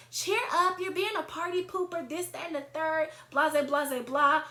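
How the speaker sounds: mocking party-girl chiding; mock dismissive nagging. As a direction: mimic her eye-rolling, then wave it off as blah-blah. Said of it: "she rolls her eyes and she's like".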